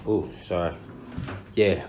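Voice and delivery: ordinary talk, nothing marked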